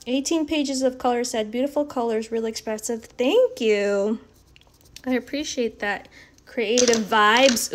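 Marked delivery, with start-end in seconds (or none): 6.4-7.7 drawn-out tryout. Shown as a demonstration. Trying out a new phrase.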